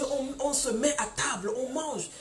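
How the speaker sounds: ordinary talk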